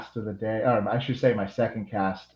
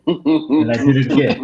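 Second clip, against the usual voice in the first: laughing through the words, a bit louder; 4 semitones higher, 7 dB louder than usual. Real, not acted. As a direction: chuckle at your own mistake. Laughing at his own blunder.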